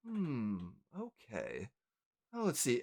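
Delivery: quiet, low murmur of consideration; pondering elf. Acted. Elf about to open the parcel, quietly pondering. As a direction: mull it over softly to yourself. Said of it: "elf behind the, the desk goes".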